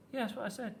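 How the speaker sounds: put-on high child's voice, answering back; little niece. A narrator's reading. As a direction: retort like a small girl being corrected. Niece retorts when corrected on name.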